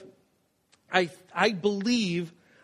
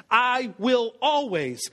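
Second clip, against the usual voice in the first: "always" stretched slow and louder; slow vow; 4 semitones higher, 3 dB louder, slower than usual. Shown as a demonstration. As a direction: lean on "I will always" slowly. Declaring a vow.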